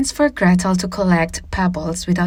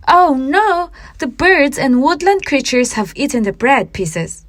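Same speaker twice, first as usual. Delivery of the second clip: high, loud cry of dismay; a girl discovering the bread trail is gone; dismayed exclaim; 6 semitones higher, 4 dB louder than usual. A narrator's reading. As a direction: burst out in alarm.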